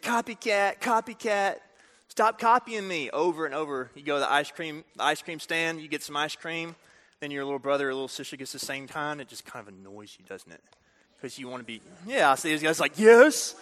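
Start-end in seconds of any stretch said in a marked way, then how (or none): 0.0-3.1 put-on high, sing-out kid's taunt. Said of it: "You ever say that when you're a kid?". 13.0-13.6 loud, high cheering yes; exultant yes. Retold or imitated. Acts out guys in the crowd.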